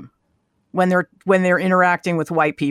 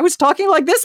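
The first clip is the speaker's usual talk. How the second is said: mock Irish lilt, the voice going up and down; a light sing-song Irish lilt; 9 semitones higher than usual. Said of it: "My, my voice was going up and down".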